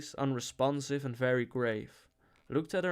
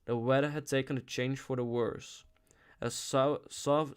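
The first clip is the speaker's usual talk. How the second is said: usual speaking voice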